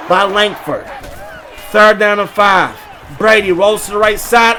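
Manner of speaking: normal talking voice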